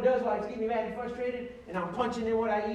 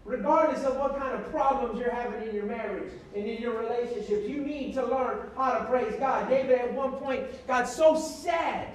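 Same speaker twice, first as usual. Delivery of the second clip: raised, impassioned preacher's cadence; preaching; 4 semitones higher, 4 dB louder than usual. Acted. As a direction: preach it to the congregation, building. Preaching about King David.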